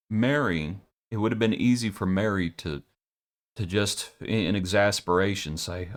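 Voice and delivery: normal talking voice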